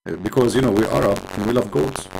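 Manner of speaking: ordinary talk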